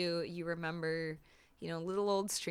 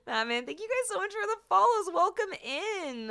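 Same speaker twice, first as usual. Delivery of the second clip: excited; 11 semitones higher, 5 dB louder than usual. Real, not acted. Excited thanks to followers.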